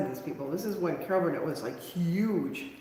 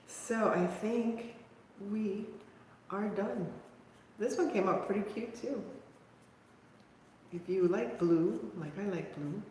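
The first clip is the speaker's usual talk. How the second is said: soft wrap-up; 8 dB quieter than usual. Real, not acted. Softly wrapping up the craft.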